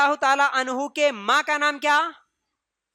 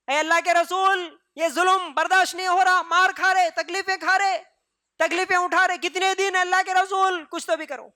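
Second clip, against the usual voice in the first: raised, high pleading cry to the Prophet; pleading call; 6 semitones higher than usual. Retold or imitated. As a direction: call out in anguish, begging for help. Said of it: "پکار کے بولے". (بلند آواز میں فریاد)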